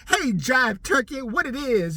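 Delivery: clumsy imitation of seventies slang; seventies jive talk. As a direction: ham up dated seventies street talk. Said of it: "bad shit from the seventies".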